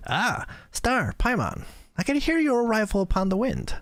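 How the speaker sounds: put-on high, light girlish voice. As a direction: pitch up into a young girl's voice. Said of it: "basically a girl voice".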